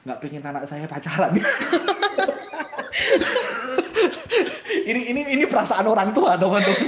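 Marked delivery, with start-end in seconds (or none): 1.4-4.8 long, high burst of laughter; big laugh. Real, not acted. Father admitting he opposes dating, loud high laughter. Laughs at own parental feeling. 4.8-6.9 loud, high, rushed through real laughter; laughing while talking. Real, not acted. Father confessing parental feelings to audience, laughing hard.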